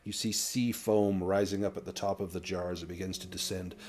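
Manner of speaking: game master's narration. A narrator's reading. Game master describing the sealed jar to players, hushed measured narration. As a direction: narrate solemnly, letting phrases fall away quietly.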